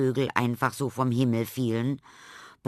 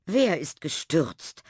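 higher, drawn-out startled call; a mild call back across the yard; 7 semitones higher, slower than usual. A narrator's reading. Teacher calls back to Tilda at the gate, mildly raised call. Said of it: "rief Frau Lerche zurück".

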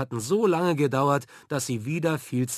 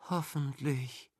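soft, slow whispered Hoffentlich; 6 dB quieter than usual. A narrator's reading. Sick girl in her father's arms, a slow soft whisper. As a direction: breathe it out, softer and slow. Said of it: "flüsterte sie".

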